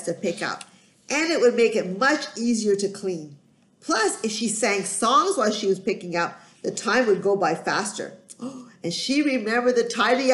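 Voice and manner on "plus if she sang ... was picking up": usual speaking voice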